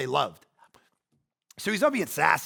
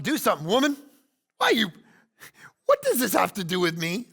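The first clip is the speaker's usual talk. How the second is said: higher, louder lazy brush-off to mom; sassy dismissive Jesus; 5 semitones higher, 5 dB louder than usual. Retold or imitated. Jesus waving off his mother, sassy and dismissive. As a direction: shrug her off like a slacker with a toothpick. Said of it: "He's got a toothpick".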